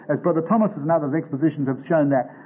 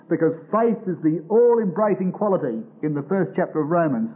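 normal talking voice

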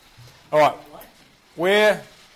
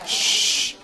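sharp hushing hiss; shush; 3 dB louder than usual. Real, not acted. Teacher quieting a restless class, sharp shush.

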